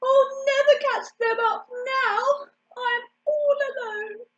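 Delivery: high, whimpering duckling; tearful. A narrator's reading. Ugly duckling, all alone, tearful. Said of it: "sniffed the ugly duckling".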